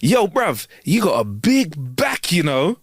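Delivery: slow, drawn-out cheeky remark; imitated stranger. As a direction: call out like a bloke in a shop. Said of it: "all I heard was".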